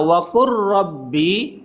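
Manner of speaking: "wa qur rabbi" slow, lam swallowed into rolled ro; lam merged into ro. Shown as a demonstration. Teacher showing lam merged into ro, slow. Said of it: "lam dan ro digabungkan".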